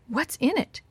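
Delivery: lightly acted anxious gasp, rising at the end; gasped question. A narrator's reading. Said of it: "Tom gasped and grabbed".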